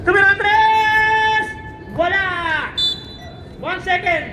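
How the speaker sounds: excited countdown call of the closing seconds; urgent courtside announcer; live play-by-play. Real, not acted. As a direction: shout the shot, stretching the big words.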